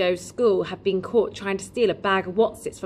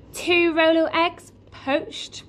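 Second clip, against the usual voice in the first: kindly shopkeeper voice; 9 semitones higher, 4 dB louder, slower than usual. Acted. Raj offers breakfast.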